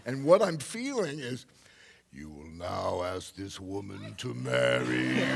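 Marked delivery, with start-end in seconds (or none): 2.1-5.3 commanding inner voice. Retold or imitated.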